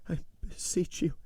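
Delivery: high, faltering, pleading woman's voice; stammering. A narrator's reading. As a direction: beg him, halting and frightened. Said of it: "she stammered".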